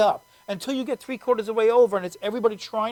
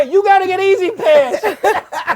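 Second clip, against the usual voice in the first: slow, higher, louder amused command; laughing insistence; 8 semitones higher, 8 dB louder, much slower than usual. Real, not acted. Host laughing, insisting a friend get E-ZPass. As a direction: laugh through the order.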